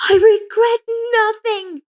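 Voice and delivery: a high, slow hysterical scream. A narrator's reading. Frida caught by police, screaming hysterically. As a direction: shriek it out, slow and unhinged. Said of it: "Frida screamed hysterically".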